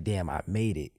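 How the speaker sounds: a quiet retold whew; relieved sigh. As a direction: let it all go under your breath. Retold relief arriving just in time.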